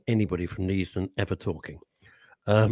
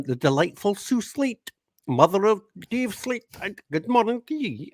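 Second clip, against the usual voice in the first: put-on voice; 10 semitones higher than usual. Presenter reading Sue's message in a high put-on voice. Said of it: "Does Sue even speak like that?".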